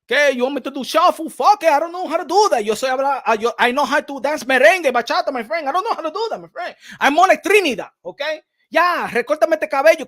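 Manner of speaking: hammed-up boast about dancing; playful Dominican fighter bravado; imitating Michel Rivera. Continuing Michel Rivera impression.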